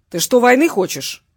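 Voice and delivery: loud, aggressive challenge; angry threat. A narrator's reading. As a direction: confront him loudly, spoiling for a fight. Angry threat over the phone. (громко, с угрозой)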